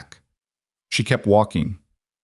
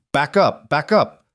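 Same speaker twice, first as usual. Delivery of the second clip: raised, higher voice, urgent command; yelling; 6 semitones higher, slower than usual. A narrator's reading. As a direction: yell it twice as a warning. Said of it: "Pruitt was yelling".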